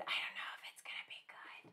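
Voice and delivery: hushed imitation of a doubtful child; whispering someone else's words; whispered quote. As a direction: pass on a child's worry in a whisper. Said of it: "He said".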